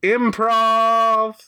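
drawn-out showman announcement; emcee intro. Acted. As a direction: announce it like a host opening the show. Opening the show like an emcee.

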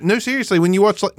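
high coaxing plea; lightly mocked high pleading; pleading husband. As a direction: try to talk her into it. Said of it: "And I was like".

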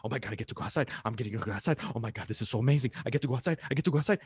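hushed, giddy chant, lightly voiced dog; excited dog's thoughts. Dog thinking at the door about going outside, lightly excited. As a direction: bubble over under your breath, repeating eagerly.

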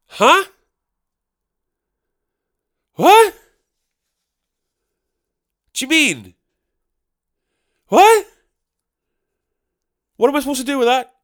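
high incredulous yelling of Huh and What; a comic yelled double take, totally baffled; baffled outburst. Retold or imitated. Baffled reaction to heckler.

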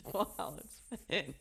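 ordinary talk

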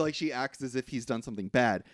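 ordinary talk, nothing marked

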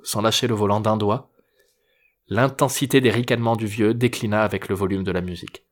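usual speaking voice

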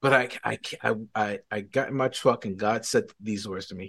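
ordinary delivery

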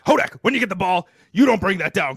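loud barking basketball coach; coach impression. Shown as a demonstration. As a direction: yell it like a coach on the sideline. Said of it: "I'll do my coach's impress-, impression".

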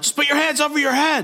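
high, strained cop command; mock police shout. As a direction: imitate a frantic officer giving orders. Cops shouting orders at suspect.

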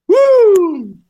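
high whooping yell from the heat; loud whoop. Real, not acted. Reacting to hot sauce.

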